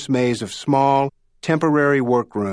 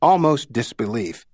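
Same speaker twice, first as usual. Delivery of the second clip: usual speaking voice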